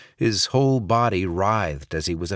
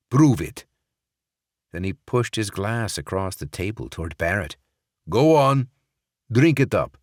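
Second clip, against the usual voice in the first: stiffening demand; 4 dB louder than usual. Acted. Sokolov ordering Barrett to drink, voice stiffening. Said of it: "his voice stiffening with each demand".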